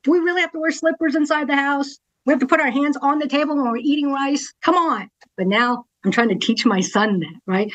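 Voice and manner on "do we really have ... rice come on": higher, fast mock whine of a fed-up kid; exasperated kid complaining. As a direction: whine like a child sick of the house rules. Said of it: "I'm like".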